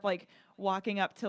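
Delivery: ordinary talk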